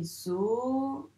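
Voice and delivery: zoo drawn out slowly as zo... o; slow two-beat zoo; split into syllables. Shown as a demonstration. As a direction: stretch each syllable of zoo, pausing between. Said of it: "il y en a deux".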